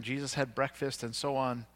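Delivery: normal talking voice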